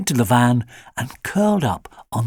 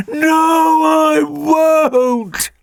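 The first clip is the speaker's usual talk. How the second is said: high, louder crying refusal from a dog; crying shout; 6 dB louder, much slower than usual. A narrator's reading. Alfie cries out from the van, high crying dog shout. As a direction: wail it out in despair.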